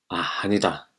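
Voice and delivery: ordinary delivery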